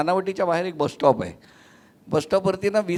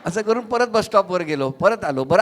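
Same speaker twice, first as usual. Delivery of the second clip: ordinary delivery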